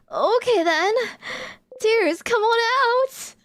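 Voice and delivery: hammed-up pep shout at her own eyes; forced determination. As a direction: psych yourself up and order the tears out. Character tries forcing tears.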